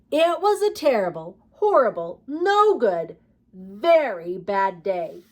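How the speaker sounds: put-on reading a picture book aloud to children; lively read-aloud with emphatic refrains; story narration. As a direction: tell it like a storybook for kids.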